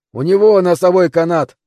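high, loud warning shout. Acted. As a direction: shout it over the storm. Man shouts in storm. (громкий крик)

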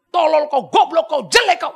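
loud, high mock abuse; acted-out scolding; hurled insults. Shown as a demonstration. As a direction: fling each insult out forcefully. Demonstrates insulting speech.

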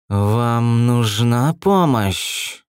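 slow, drawn-out serpent hiss; hissing snake voice. A narrator's reading. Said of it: "раздался шипящий голос".

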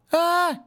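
high loud squeal of fright; a short frightened shriek; boy's squeal. A narrator's reading. Scared boy squeals. (испуганный визг)

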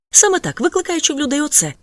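very high, loud indignant repeat; mother repeating her accusation, riled up. A narrator's reading. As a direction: hammer the accusation home.

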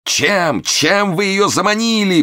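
high, drawn-out melodramatic wail; anguished moan. A narrator's reading. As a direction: moan it like a betrayed husband. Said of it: "простонал Момус". (протяжно стонет с надрывом)

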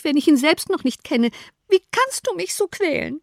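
a wife pleading she is being tormented; plaintive mother. A narrator's reading.

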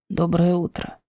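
low, drowsy, husky morning voice; just woken, rasp it out low; sleepy hoarse voice. Acted. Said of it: "произносит хриплым сонным голосом". (хриплый сонный голос)

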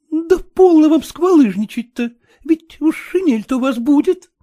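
high, loud teasing scold; shouting at Kutsin. A narrator's reading. Agniya shouting at Kutsin again, high and loud. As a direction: snap at him, half joking. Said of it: "опять закричала на Куцина Агнечка".

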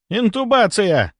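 high, drawn-out exclamation; urgent suggestion. A narrator's reading. A doctor proposes intubation in the emergency, urgently. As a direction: call out the procedure.

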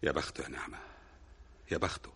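soft wistful sigh. Acted. Husband sighs over his lot.

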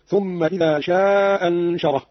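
شاء أنشره with a long-held "shaaa" and one hamza; dropped hamza with madd. Shown as a demonstration. Teacher demonstrating the madd, شاء held long before أنشره. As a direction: stretch the aa of شاء slowly into أنشره. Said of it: "والمد هكذا".

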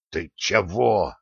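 slow, drawn-out hissed question; mildly alarmed hiss, stretched out. A narrator's reading. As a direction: hiss it slowly at a comrade. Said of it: "прошипел Милован".

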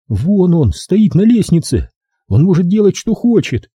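high-pitched, resentful retort; high and exasperated; resentful captive woman. A narrator's reading. Captive woman points out captor.

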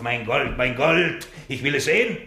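building from eager to loud and high; rising greedy excitement. Acted. Miser demanding to see his gold, rising greed.

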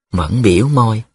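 ordinary delivery, nothing marked

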